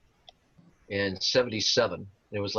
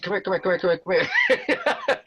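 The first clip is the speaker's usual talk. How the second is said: put-on higher, eager calling of the old lady; imitating aunt calling; 10 semitones higher, 4 dB louder than usual. Old lady at Graceland calling the band over, high and eager. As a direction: beckon them over again and again.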